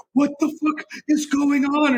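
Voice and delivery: high, panicked squeak of disbelief; bewildered panic. Retold or imitated. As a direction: squeal it like a guy totally lost. Bewildered victim voiced in retelling.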